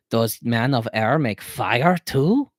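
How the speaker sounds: very slow, loud caveman talk. A narrator's reading. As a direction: ask it like a mock-awed caveman, word by word. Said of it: "said in a caveman voice".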